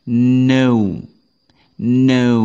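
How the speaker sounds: a clear No, silent K; model to repeat. Shown as a demonstration. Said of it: "Coba tirukan".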